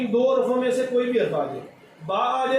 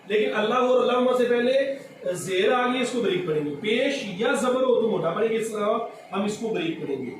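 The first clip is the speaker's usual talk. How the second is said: ordinary talk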